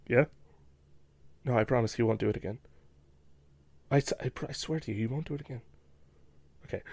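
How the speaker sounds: quick, quiet pleading into phone; hushed phone apology. Pretend. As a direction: mumble a nervous promise. Pretend phone call apologizing.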